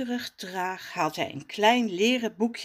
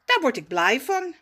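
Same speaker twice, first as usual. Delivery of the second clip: put-on happy boy; 6 semitones higher, 5 dB louder than usual. Raf happy.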